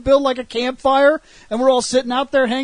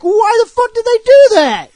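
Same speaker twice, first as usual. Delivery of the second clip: high, loud incredulous outburst; hammed-up baffled shout; mock exasperated yell; 12 semitones higher, 9 dB louder than usual. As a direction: shout it in disbelief at the absurdity. Retold exasperated sketch line.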